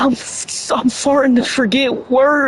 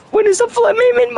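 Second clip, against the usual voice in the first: high-pitched disbelief; 10 semitones higher, much faster than usual. Real, not acted. Tongue-tied streamer losing it.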